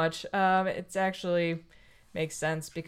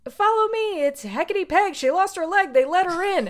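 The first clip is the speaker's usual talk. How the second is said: high, bright little bird voice, a bit louder; talking bird; 8 semitones higher, 4 dB louder than usual. Retold or imitated. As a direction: chirp it out like a helpful talking bird. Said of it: "And the bird says".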